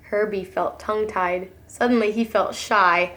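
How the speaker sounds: ordinary delivery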